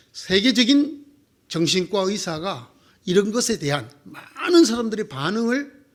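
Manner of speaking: ordinary talk, nothing marked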